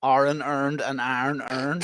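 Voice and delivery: slow, broad Northern Irish "Iron earned and iron earned"; drawn-out Northern Irish "Iron earned and iron earned"; Northern Irish accent. Shown as a demonstration. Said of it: "Just say it like you would normally say it".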